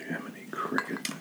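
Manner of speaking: breathy whispered exclamation. Real, not acted. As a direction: hush it under the breath. Reacting to hot sauce.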